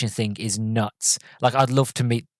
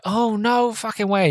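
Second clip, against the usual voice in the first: high-pitched delighted recognition; imitated excited disbelief; 10 semitones higher, slower than usual. Chaz hearing of his friend Jeremy, imitated excited disbelief. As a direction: exclaim it much higher, acting thrilled.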